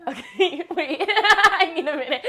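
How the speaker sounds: breaking into real giggles; laughing. Real, not acted. Presenter needs a minute mid-take, laughing. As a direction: talk through a fit of laughter. Cracking up during filming.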